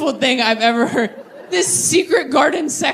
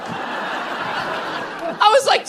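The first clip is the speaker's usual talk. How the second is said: stunned laugh; 9 semitones higher, 3 dB quieter, much slower than usual. Real, not acted.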